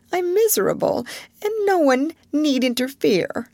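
a touch of tearful stubbornness; miserable sulk. A narrator's reading. Ermengarde turning her back on Jessie, hurt and sulky. Ermengarde sulks after crying.

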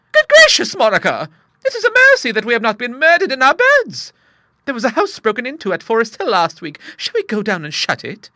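very high, loud shocked cry; panicking about burglars; terrified old lady. Acted. Bertha fearing murderers in the house, very high.

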